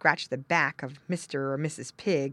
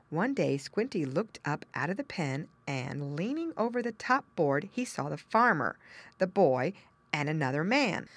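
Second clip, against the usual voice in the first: ordinary delivery, nothing marked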